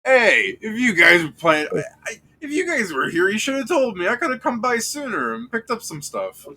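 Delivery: high, bright NPC guard voice, calling out; Dustin's voice. Acted. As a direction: play Dustin, pitched well up and eager. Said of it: "and he's just like".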